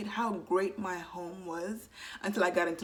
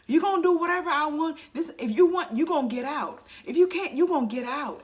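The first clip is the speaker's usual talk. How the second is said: loud, much higher mock parent yelling orders; bossy parent; 8 semitones higher, 6 dB louder than usual. Controlling parent threatening the child, loud mock yell. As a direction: bark it like a strict parent laying down the law.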